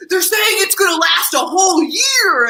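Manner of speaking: high, loud alarmist warning; alarmed shout. Retold or imitated. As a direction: blurt the warning in panic. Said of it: "I was like".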